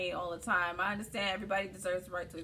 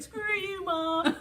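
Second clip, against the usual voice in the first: high, loud bratty-kid impression; little boy; 11 semitones higher, 4 dB louder, slower than usual. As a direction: play a whining eight-year-old for laughs. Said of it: "like, a little Caucasian eight-year-old boy".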